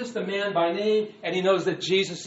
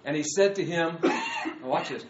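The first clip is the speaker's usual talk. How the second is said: usual speaking voice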